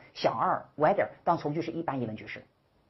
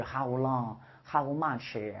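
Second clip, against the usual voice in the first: drawn out list; 2 semitones lower, much slower than usual. Shown as a demonstration. Teacher lists words dragged out.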